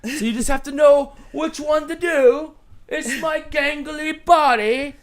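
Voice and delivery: loud, high, hammed-up British voice; bad British accent. Host shows off his British accent to the panel, loud and mock-British. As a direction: crow it in an over-the-top fake British accent. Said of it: "It's time for my British accent".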